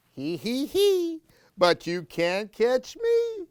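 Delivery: mock giggle rising high; giggling boy. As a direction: tease like a child running away. Gabriel giggles and runs.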